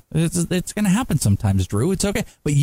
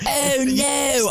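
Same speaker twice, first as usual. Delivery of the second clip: high, exclaimed fake English accent; Aldous Snow, English accent; 9 semitones higher than usual. Co-host mocking an actor's rock star impression, bad English accent. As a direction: cry it out in a terrible English accent. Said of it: "I'm Jonah Hill doing Aldous Snow".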